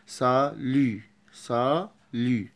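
very slow سالي; slow, careful سالي; slow demo. Shown as a demonstration. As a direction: draw out سالي for the class. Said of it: "حاجة مكسورة ضمة شوية".

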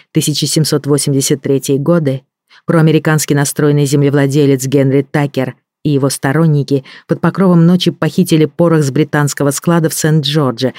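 usual speaking voice

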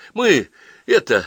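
high, drawn-out hesitation; flustered. Acted. Daughter caught by her mother, flustered. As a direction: stall as if caught out. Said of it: "растерялась Ася".

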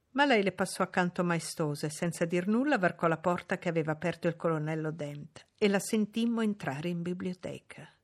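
usual speaking voice

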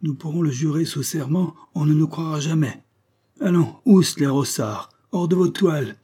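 high, loud amazed outburst; astonished skipper hollering at slackers; shouting captain. A narrator's reading. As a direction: rouse the sleepers with shouted orders. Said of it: "cria Fourgue".

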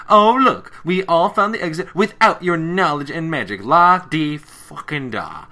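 hammed-up sarcastic shout; sarcastic yell. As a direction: sneer it at her. Applejack sarcastic quote.

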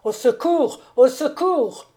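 loud, high-pitched shouts; crying for help. A narrator's reading. Count rushes out of the room for servants, crying out. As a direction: call out urgently, climbing on the repeat. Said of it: "s'élança hors de la chambre en criant".

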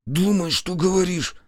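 high, tense scolding hiss; hissed rebuke. A narrator's reading. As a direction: scold a careless friend urgently. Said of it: "прошипел он". (шипя, с укором)

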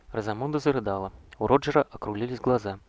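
ordinary delivery

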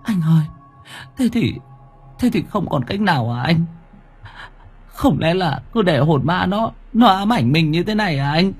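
high, hesitant worried woman. A narrator's reading. Girlfriend asks if there is no way out, worried. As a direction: ask in a high voice, faltering with worry. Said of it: "My lo lắng".

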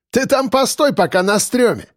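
high-pitched jeering order; smirking. A narrator's reading. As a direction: brush him off with a smirk. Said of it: "усмехнулся Худой".

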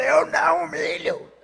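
strangled defiance. Pretend. Júlio answering God while pinned down, strained and defiant. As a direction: refuse through a crushed throat. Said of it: "ele fala shout".

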